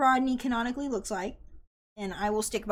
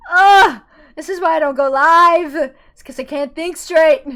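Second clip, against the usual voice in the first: loud, raised, exasperated groaning rant; frustrated yell; 6 semitones higher, 16 dB louder than usual. Real, not acted. Streamer complaining about going live, frustrated yell. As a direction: groan loudly, then complain at high volume.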